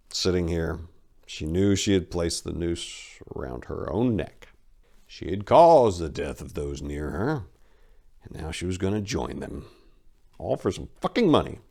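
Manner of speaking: ordinary delivery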